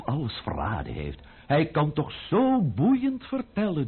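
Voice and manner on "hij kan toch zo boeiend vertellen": high, sarcastic mock praise. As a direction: pretend to admire him, mocking.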